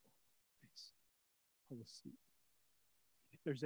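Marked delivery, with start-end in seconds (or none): 0.1-2.2 much softer, lower, nearly breathless reply; hushed devotion. Acted. The praying heart answering God, hushed and low.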